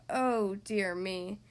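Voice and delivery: a slow fretful gasp of concern; slow low worried voice; worried Trevor. Acted. Trevor sees pile wobbling.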